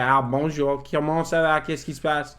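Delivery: fluent French accent, run together; easy French intonation and accent. Shown as a demonstration. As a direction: speak it with a proper French accent. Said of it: "important to do the French accent".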